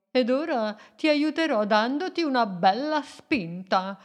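put-on a wise old sea creature, taking his time; kindly octopus voice. Octopus Doroteo speaks.

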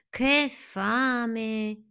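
high, drawn-out exclamation; hungry caterpillars. A narrator's reading. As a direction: wail hungrily in a little voice. Both caterpillars exclaim their hunger.

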